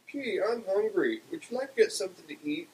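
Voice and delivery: high, singled-out woman's part; Beulah's character voice. A narrator's reading. Beulah inviting Barney to eat, high character voice.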